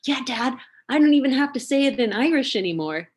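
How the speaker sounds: put-on high, loud, proud little boy; imitating an overjoyed kid brother; so-happy kid. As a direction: crow to dad like a delighted kid. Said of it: "like, so happy".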